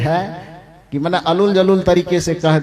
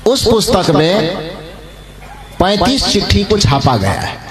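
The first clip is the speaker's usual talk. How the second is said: louder, deliberate sermon delivery; raised preaching voice; emphatic preaching; 4 dB louder than usual. Acted. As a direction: press the point home to the congregation. Preacher emphasising the printed letters.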